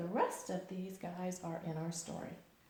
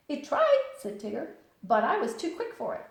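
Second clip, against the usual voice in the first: very high, loud bouncy hello; squeaky bright Tigger greeting; 7 semitones higher, 8 dB louder than usual. Acted. Tigger answering Pooh's hello outside the door.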